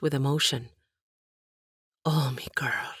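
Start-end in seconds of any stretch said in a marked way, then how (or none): 2.1-2.9 soft unvoiced tender whisper; whispered comfort. Aunt comforts Katie in a whisper. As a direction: breathe it to the girl.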